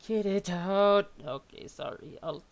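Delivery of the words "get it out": loud slow shouted demand; yell. Acted. Character yelling at the robot to remove the chip. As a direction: bellow it out, dragging the words. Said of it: "no, I can't yell".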